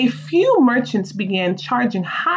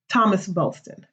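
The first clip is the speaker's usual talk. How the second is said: ordinary delivery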